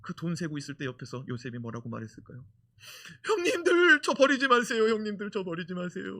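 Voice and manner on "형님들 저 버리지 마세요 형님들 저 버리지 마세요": begging, crying. Retold or imitated. Joseph begs his brothers while they count money, crying. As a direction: beg the brothers not to leave you, in tears. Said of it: "아주 애걸했다는 거예요".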